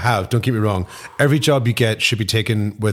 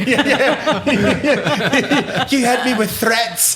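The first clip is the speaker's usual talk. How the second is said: high, slow words through laughter; laughing; 11 semitones higher, much slower than usual. Real, not acted. Topping the joke about threats, helpless high laughter. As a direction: squeeze the joke out while cracking up. Joking along while laughing hard.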